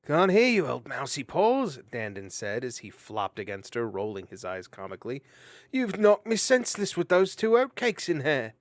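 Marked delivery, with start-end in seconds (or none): 0.1-1.8 teasing. Acted. Dandin teases Mariel. 5.7-8.5 comic complaint. Acted.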